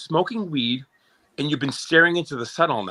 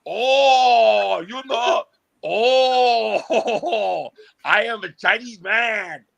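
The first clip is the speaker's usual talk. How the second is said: higher mock Chinese accent, laughing through it; 4 semitones higher, much slower than usual. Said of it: "you wanna do my Chinese?".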